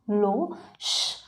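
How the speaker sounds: a soft, slow, drawn-out "श"; shushing. Shown as a demonstration. Teacher demos hushing a noisy class, soft shush. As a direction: hush the room with a long "श". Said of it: "इसका pronunciation होता है श".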